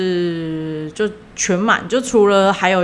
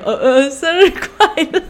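awkward; 9 semitones higher, 4 dB louder, slower than usual. Retold or imitated. Awkward table greeting.

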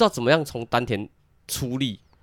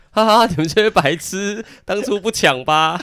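slow, higher sneering taunt; mocking gloat; 6 semitones higher, 3 dB louder, slower than usual. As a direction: gloat down at the fools. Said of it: "那些先知在笑我们".

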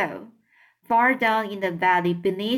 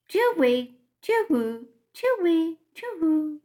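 owl hoots; 8 semitones higher than usual. A narrator's reading. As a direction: hoot it out like owls across the forest. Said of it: "called out to each other".

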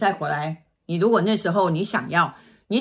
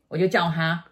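normal talking voice

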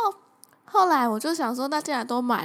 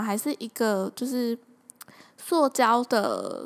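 ordinary talk, nothing marked

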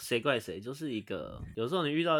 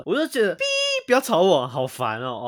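loud, higher, exasperated repeat of the line; mock irritated quote; 3 semitones higher, 10 dB louder than usual. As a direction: throw the quote back loud and annoyed. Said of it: "那三个字".

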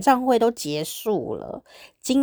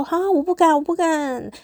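higher, squeaky mock-timid refusal; bashful refusal; 9 semitones higher than usual. Shy fan refuses to invite her idols, high and timid. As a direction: shrink back like a shy fangirl. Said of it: "我就想说".

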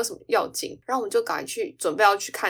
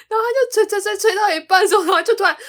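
high, louder, laughing through the telling; laughing storytelling; 12 semitones higher, 7 dB louder than usual. Real, not acted. Telling cohost about friend blowing balloon, laughing. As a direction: tell it while cracking up.

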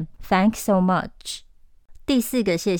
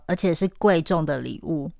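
usual speaking voice

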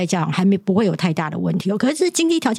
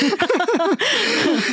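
a high, bright burst of laughter; hearty laugh; 12 semitones higher than usual. Real, not acted. Host laughing at his own joke about a five-hour show, hearty laugh.

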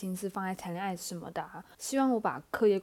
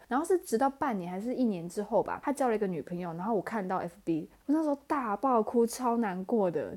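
normal talking voice

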